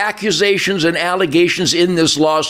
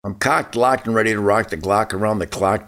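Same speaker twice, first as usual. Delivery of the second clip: low, hushed catchphrase delivery; rhyming sign-off patter; 8 semitones lower, 4 dB quieter than usual. Acted. Guest signing off the show with a rhyming boast, low patter. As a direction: drop low and softer, rolling through the rhymes.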